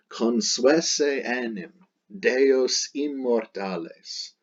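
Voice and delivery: slow, careful recitation of the Latin text; reading Latin aloud. Acted. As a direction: read the passage slowly and deliberately, word by word. Reads the Latin passage aloud.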